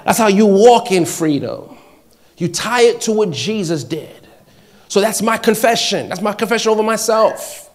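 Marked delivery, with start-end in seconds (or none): 0.0-4.3 loud, higher, driving pulpit delivery; emphatic, raised-voice sermon; forceful preaching. Real, not acted. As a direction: preach it hard with ringing emphasis.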